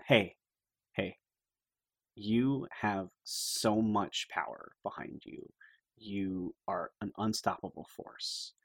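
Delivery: soft, slow, earnest encouragement; intimate pep talk. Acted. Friend gives Phaedra emotional support before fight, soft slow pep talk. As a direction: pump her up quietly, word by word. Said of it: "pump her up a lot".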